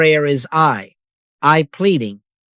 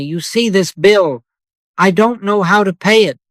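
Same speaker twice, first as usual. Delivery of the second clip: higher, rising to a tense plea; anxious pleading prayer; 6 semitones higher than usual. Retold or imitated. Worshipper begging God about an unpaid bill, anxious and higher. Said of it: "the tongue begins its sprint".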